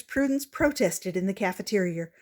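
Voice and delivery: ordinary delivery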